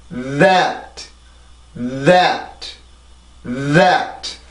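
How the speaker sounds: "That" with a long buzzing voiced th; "That" with lots of air and voice at the start; English th demo. Shown as a demonstration. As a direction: hum through the th, then rise into "at". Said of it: "이렇게 소리가 되는데".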